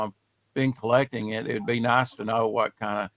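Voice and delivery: ordinary talk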